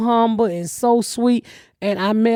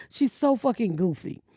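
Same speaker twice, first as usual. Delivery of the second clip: normal talking voice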